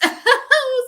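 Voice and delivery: short high laugh at herself; embarrassed laugh. Real, not acted. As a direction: laugh off the hiccups. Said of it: "laughs at her hiccups".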